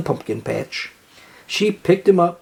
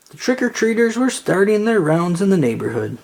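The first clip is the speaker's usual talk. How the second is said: plain reading voice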